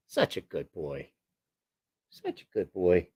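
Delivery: low, soft pet talk; tender praise. Real, not acted. Owner praising Shopcat as a good boy, low tender voice.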